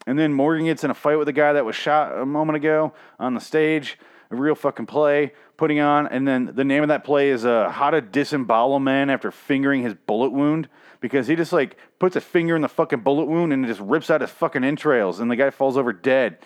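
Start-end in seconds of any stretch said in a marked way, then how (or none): none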